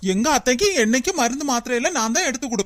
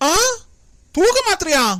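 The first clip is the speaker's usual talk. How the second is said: higher, louder shocked question; shocked gasp; 7 semitones higher, 4 dB louder than usual. Acted. Doctor hears about sleeping pills and blurts out a shocked question. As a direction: blurt it out in alarm.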